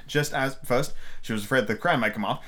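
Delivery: plain reading voice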